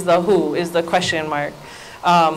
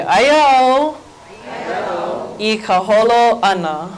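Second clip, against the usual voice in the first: call-and-response model. Shown as a demonstration. Teacher leading everybody in the Hawaiian sentence, phrase by phrase. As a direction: break it after "Aya au" for the class to echo. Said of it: "So everybody say".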